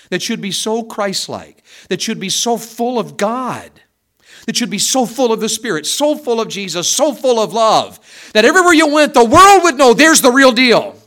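loud, high, building sermon climax; impassioned revival preacher, voice soaring; fiery preaching. Acted. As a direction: preach it out with rising fervor. Preaching at full force.

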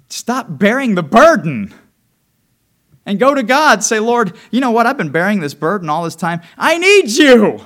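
loud, rising preacher's plea; preaching at full force. Acted. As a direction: urge the church to pray for help. Preaching climax to congregation.

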